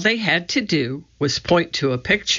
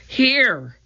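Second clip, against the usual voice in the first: calling out; 10 semitones higher than usual. A narrator's reading. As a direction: answer from up above. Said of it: "she called".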